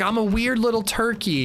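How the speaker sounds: high, silly self-mocking voice; mock Laura Palmer. Laura Palmer calling herself a turkey, high mock voice. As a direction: pitch it up like a goofy girl. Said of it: "She's like".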